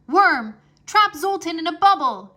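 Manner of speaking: high, loud command; shout. Acted. Drake ordering Worm to trap the wizard, shouted. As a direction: yell the order to your dragon. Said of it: "he shouted".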